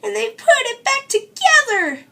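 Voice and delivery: high, loud whiny cub; high cub voice wailing about his chair; screeching baby bear. A narrator's reading. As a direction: stomp and whine like a cub. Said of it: "Baby Bear screeched, stomping".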